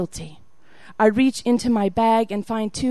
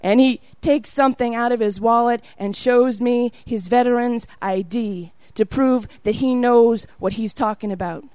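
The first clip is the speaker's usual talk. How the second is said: usual speaking voice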